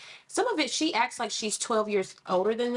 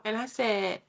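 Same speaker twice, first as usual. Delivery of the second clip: ordinary talk, nothing marked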